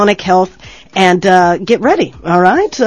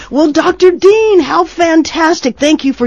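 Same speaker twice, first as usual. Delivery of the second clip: excited; 9 semitones higher than usual. Real, not acted. Host thanking guest enthusiastically.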